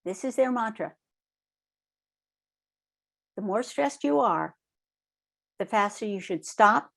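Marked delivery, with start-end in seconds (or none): none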